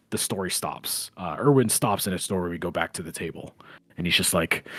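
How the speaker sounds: usual speaking voice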